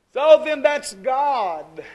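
slow, loud pulpit boom; booming preacher. Real, not acted. Preacher to congregation, a slow booming declaration. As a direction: thunder it out slowly from the pulpit.

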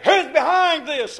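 slow, loud and high; demanding cry. Retold or imitated. Preacher acting Pharaoh questioning Moses, slow loud cry. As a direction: call out the demand slowly.

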